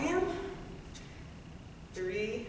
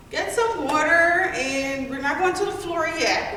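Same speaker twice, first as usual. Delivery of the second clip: loud; 6 semitones higher, 4 dB louder, slower than usual. Real, not acted. Instructor cheering class to finish.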